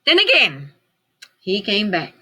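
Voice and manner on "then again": ordinary delivery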